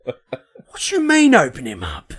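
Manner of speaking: slow, high-pitched put-on movie voice; film character impression. Quoting Snatch back to friends, slow put-on character voice. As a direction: stretch out the quote in character.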